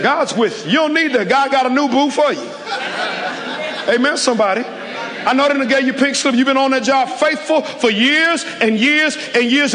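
rising, pressing sermon climax; preaching at full force. Acted. Pastor urging the congregation, in full preaching cry.

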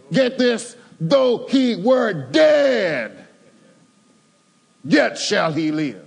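high, slow, ringing proclamation; proclaiming loudly. Acted. Preacher voicing Jesus to the grieving sister, ringing proclamation. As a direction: declare it like the Lord himself. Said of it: "Jesus said unto her".

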